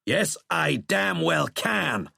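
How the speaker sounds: high, slow, defiant retort; angry retort. A narrator's reading. General insisting he can shout at the president, angry. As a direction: lean hard on it, defiantly.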